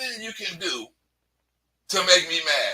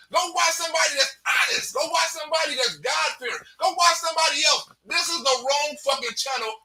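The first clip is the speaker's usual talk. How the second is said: angry shouting; 4 semitones higher than usual. Real, not acted. Ranting at haters.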